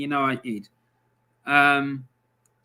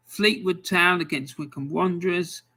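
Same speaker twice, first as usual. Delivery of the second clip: usual speaking voice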